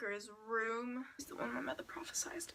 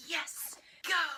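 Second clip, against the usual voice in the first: cheering; 5 semitones higher, 7 dB louder than usual. Retold or imitated. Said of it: "I was like".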